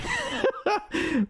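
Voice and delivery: high burst of laughter; amused laugh. Real, not acted. Streamer laughing at the obvious accent, high laugh. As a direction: laugh in disbelief.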